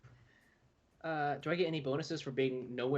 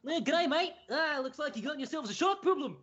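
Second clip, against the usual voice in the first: crocodile hunter; 12 semitones higher than usual. Pretend. Player voicing the shark-hunting Aussie, high and hammed-up. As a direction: play the excitable Aussie croc wrangler, pitched way up. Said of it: "make him sound like the crocodile hunter".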